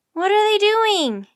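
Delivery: loud, high yell, drawn out; high-pitched loud demand. A narrator's reading. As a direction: yell the question out, high and slow. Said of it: "Annie yelled".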